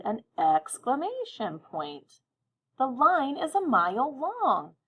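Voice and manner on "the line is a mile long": excited. Shown as a demonstration. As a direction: say it like it's amazing news. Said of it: "Did I sound a little excited?".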